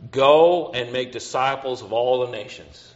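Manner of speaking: a preacher's emphatic declaration; emphatic repeat. Real, not acted. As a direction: proclaim the command to the congregation.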